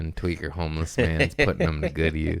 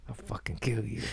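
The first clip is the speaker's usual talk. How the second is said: hissed, much higher mock fury; half-joking whispered threat; mock rage whisper; 5 semitones higher, 14 dB quieter than usual. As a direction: whisper a kid's murderous threat. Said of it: "I'm like".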